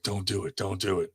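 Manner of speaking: softly pleading don't do it; an urgent near-whisper of a wife holding him back; hushed warning. Retold or imitated.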